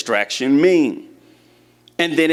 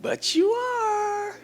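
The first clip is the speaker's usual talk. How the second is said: very high, drawn-out, softer; playful reassurance; 6 dB quieter, much slower than usual. Real, not acted. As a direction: coo it up high and stretch it out. Playfully assuring listeners they matter.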